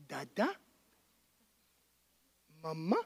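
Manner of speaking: put-on low, hushed sing-song to a toddler; gentle parent murmuring baby words; soft coaxing baby talk. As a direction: coax a baby to say her first words. God speaking like a parent.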